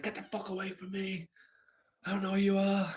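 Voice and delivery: high, strained, frightened snap; panicky retort. Retold or imitated. To the stranger at the fire: high frightened retort. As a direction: voice your tripping younger self recoiling.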